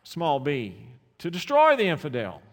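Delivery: loud, high pulpit fervour; preacher shouting to the congregation; fiery preaching. Acted. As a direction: thunder it from the pulpit. Preacher at full force.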